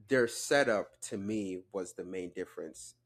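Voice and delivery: ordinary talk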